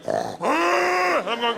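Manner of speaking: high, loud excited bear yelp; the bear lunging for a treat; overjoyed animal shout; eager bear. Retold or imitated. Bear lights up at marshmallow.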